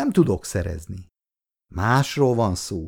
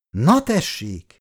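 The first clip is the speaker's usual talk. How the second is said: slow, high, exasperated outburst; cross; 6 semitones higher, slower than usual. A narrator's reading. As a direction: draw it out in annoyance. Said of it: "mondta mérgesen".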